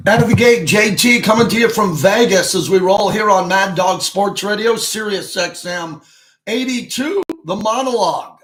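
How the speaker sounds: loud, punchy radio host intro; radio show opener. Acted. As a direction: open the show like a big announcer kicking things off.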